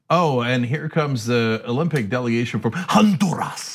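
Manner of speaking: slow announcer delivery, HONDURAS in exaggerated Spanish; over-authentic Spanish pronunciation. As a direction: roll out "Honduras" with a showy Spanish accent. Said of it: "when Bob Costas goes in the o-, opposite direction".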